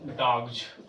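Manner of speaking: low "डॉग्स" with a "gz" ending; model word. Shown as a demonstration. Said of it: "इसे हम डॉग्स कहेंगे".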